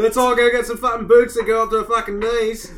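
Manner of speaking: high-pitched mock Australian; silly Australian voice for a group of guys; Australian accent. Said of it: "He's doing Australian now".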